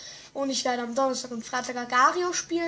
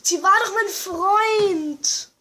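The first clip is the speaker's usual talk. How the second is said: mock wail; 6 semitones higher, 5 dB louder, much slower than usual. Mock grief over lost ally.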